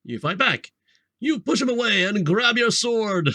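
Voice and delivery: usual speaking voice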